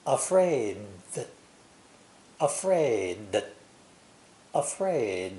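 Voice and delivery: "afraid" with the D swallowed into a TH; linked D into TH. Shown as a demonstration. Teacher showing the linked D in "afraid". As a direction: stop on the long A of "afraid", slide into TH. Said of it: "exaggerating the T H sound instead, like this".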